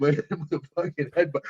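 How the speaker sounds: ordinary talk, nothing marked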